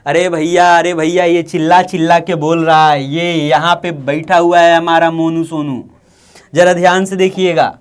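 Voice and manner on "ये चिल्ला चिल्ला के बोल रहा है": ordinary talk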